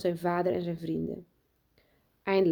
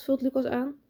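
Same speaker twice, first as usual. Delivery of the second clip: usual speaking voice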